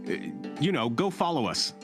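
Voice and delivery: ordinary talk, nothing marked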